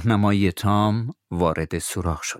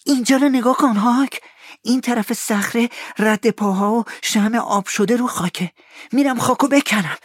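very high, louder thrill; excitedly; 4 dB louder than usual. Acted. Tom shows Huck the footprints, thrilled. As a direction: burst with excitement. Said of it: "تام با هیجان گفت".

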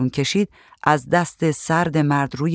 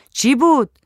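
high, raised call to another room; calling out loudly; 10 semitones higher, 4 dB louder than usual. A narrator's reading. Woman calls to whoever broke something outside, loud high call. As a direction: call out a startled question, pitched high. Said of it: "و بلند داد زد".

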